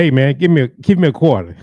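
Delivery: loud, high mock panhandler ask; imitating Troy begging. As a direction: hit him up for a quarter, pushy. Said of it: "he would say".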